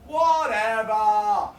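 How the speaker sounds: long drawn-out, falling "whatever"; big slow shrug of a word; dismissive refrain. Acted. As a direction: stretch both halves slowly and let it drop. Repeated refrain of performance poem.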